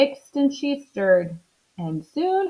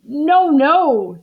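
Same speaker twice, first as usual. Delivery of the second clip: loud, high no-no; doctor stopping her; 7 semitones higher, 8 dB louder than usual. Acted. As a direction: cut her off loudly. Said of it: "said the doctor".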